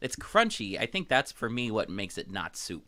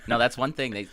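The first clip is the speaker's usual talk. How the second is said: ordinary delivery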